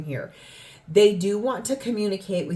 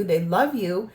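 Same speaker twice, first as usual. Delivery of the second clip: ordinary talk, nothing marked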